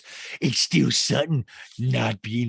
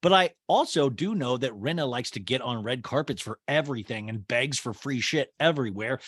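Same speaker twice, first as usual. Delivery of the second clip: ordinary talk, nothing marked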